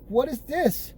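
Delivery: high-pitched incredulous question; retold disbelief. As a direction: replay being baffled by a mystery charge. Retold reaction to Amazon Music charge.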